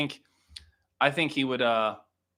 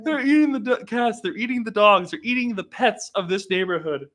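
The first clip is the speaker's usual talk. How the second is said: much higher, hammed-up alarm; Trump impression; 9 semitones higher, 4 dB louder than usual. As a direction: overblown mock warning to the neighborhood. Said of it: "Fucking Trump".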